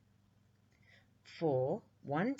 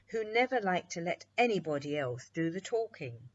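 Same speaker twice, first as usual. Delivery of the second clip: ordinary delivery, nothing marked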